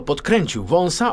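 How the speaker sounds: plain reading voice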